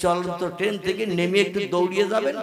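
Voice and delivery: loud, driving sermon voice; preaching. Acted. Preacher describing the train journey to listeners, loud preaching. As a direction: preach the story out to the hall.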